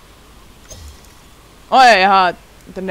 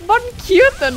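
cooing over cuteness; 9 semitones higher, slower than usual. Real, not acted. Cooing over a cute character.

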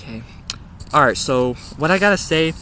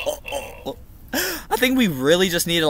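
ordinary delivery

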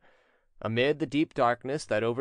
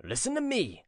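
higher-pitched stern command; severe; 11 semitones higher than usual. A narrator's reading. Prince calling his lazy servant to order, severe. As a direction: demand attention severely. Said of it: "said the prince in a severe tone".

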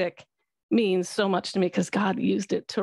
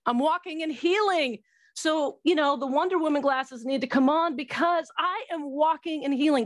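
higher, bright triumphant declaration; joyful proclamation; 7 semitones higher than usual. Real, not acted. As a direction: announce it like a victory shout of joy. Triumphant testimony of healing.

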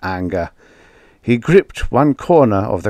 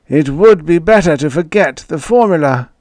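put-on high, loud old man's voice; a worn-out old inventor conceding; frail old man Ewing; 8 semitones higher, 6 dB louder than usual. A narrator's reading. Ewing conceding to Joey in a high old man's voice.

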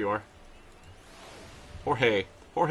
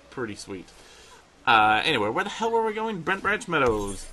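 usual speaking voice

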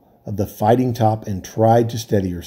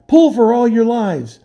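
urgent shout; 11 semitones higher, 4 dB louder than usual. Acted. Captain urging rowers.

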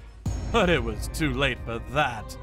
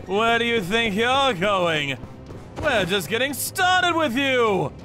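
raised, higher taunting call; menacing shout; 7 semitones higher, 3 dB louder than usual. Acted. Captor to men trying to run, menacing shout. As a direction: call after them, pitch climbing. Captor catches fleeing victims.

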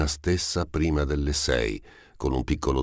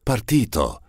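high, elated outburst; overjoyed cry; joyful exclamation; 7 semitones higher than usual. A narrator's reading. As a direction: burst out with sudden hope. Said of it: "esclamò".

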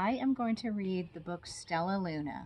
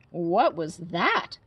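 put-on alarmed baby bird; 8 dB louder, much slower than usual. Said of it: "cried Flap".

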